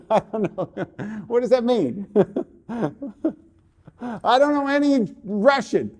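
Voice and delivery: helpless amusement, words broken by laughs; real laughing. Real, not acted. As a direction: crack up while pleading ignorance. Laughs at request to speak Russian.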